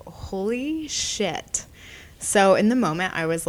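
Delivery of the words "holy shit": drawn-out, hushed "Holy shit"; slow stunned disbelief. Retold or imitated. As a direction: let the shock sink in slowly. Her reaction to the insult.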